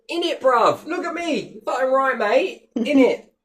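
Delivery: loud high cockney lad shouting; cockney geezer. Pretend. Mocking the commoners, a loud high cockney shout. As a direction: shout it like a cheeky London geezer.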